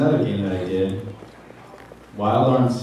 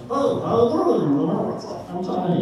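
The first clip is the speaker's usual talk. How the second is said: high, soft chicken character; silly chicken voice; 8 semitones higher, 4 dB quieter than usual. Retold or imitated. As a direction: greet people as a goofy high chicken. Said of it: "I would use that voice".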